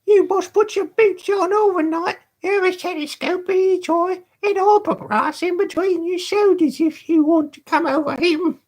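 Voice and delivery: put-on cheeky high boy teasing; high and mocking, a young rascal; high boyish Charlie Bates. Charlie Bates mocking the Dodger, high cheeky boy.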